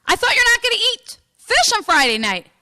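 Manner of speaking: loud, high-pitched outraged challenge; indignant accusation. Acted. Priest barges in, accusing.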